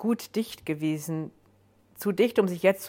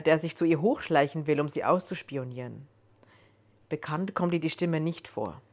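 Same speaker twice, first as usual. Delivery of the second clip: ordinary delivery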